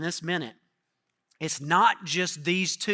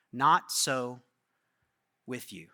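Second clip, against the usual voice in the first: solemn and soft; 8 semitones lower, 3 dB quieter, much slower than usual. A narrator's reading. Jesus quietly telling his disciples, soft and solemn. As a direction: lower and softer, solemn.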